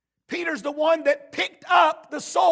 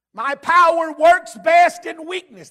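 preaching emphasis; 3 semitones higher, 9 dB louder, slower than usual. Real, not acted. Preaching God's words.

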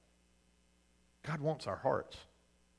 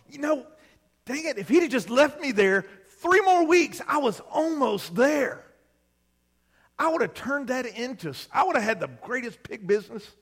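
sulky rebel son; 6 semitones higher, 4 dB louder than usual. Retold or imitated. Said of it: "we sat there on the bed and we thought".

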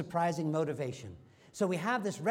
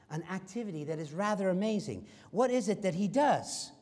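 ordinary delivery

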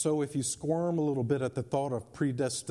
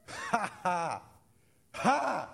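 laughing; 6 semitones higher, much slower than usual. Acted. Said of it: "He who sits in heaven laughs".

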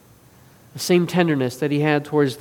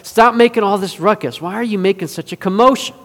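exasperated rebuke, raised voice; challenging; 4 semitones higher, 7 dB louder than usual. Retold or imitated. Jesus confronts the noisy mourners at the house, challenging. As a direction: challenge them loudly to quit it. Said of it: "And he challenges them".

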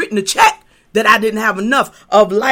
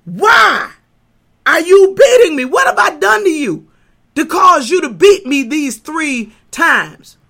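higher, louder donkey voice pleading with his master; donkey speaking; 5 semitones higher, 5 dB louder than usual. Acted. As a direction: play the wounded donkey, pitched up and loud. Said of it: "causes him to say".

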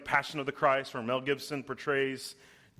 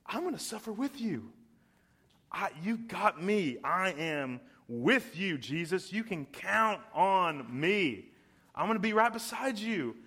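higher, fervent voice of a devoted disciple; passionate Peter pledging; 4 semitones higher than usual. Retold or imitated. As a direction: plead your loyalty to Jesus, heart on sleeve.

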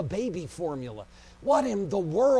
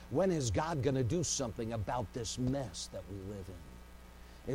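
hushed lament; 8 semitones lower, 8 dB quieter than usual. Acted. Prayer quote, dropped low.

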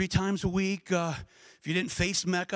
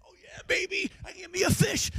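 much higher, gleeful Matt voice; excited fisherman; 12 semitones higher than usual. Pretend. Matt fishing at his country place, gleefully excited. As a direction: cheer over a caught fish. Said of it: "Matt's fishing".

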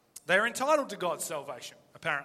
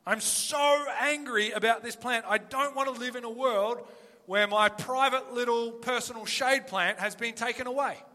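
loud, higher, hammed-up whining; petulant dramatic plea; 4 semitones higher, 6 dB louder than usual. Preacher mocking Jonah's tantrum over the plant, loud and whiny. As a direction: ham up a sulking prophet's tantrum. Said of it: "his petulant, dramatic plea".

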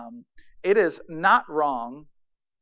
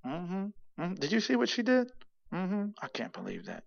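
high, soft, muttered grumbling; muttering grumbler; 5 semitones higher, 8 dB quieter than usual. Shown as a demonstration. Preacher acting out a grumbler, muttering under breath. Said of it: "This is muttering in a low tone".

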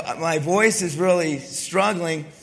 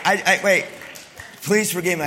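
ordinary talk

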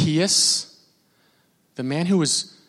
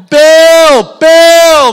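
much higher and louder shout; shouting a name; 11 semitones higher, 12 dB louder than usual. Retold or imitated. Fan at Comic-Con shouting to Shatner, loud. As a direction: shout across the crowd at a celebrity. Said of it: "I'm like".

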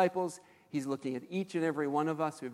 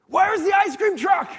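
put-on much higher, loud, slow kid yell; excited kid shout; 11 dB louder than usual. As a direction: bellow it like a desperate kid. Kid bursting out to other kids.

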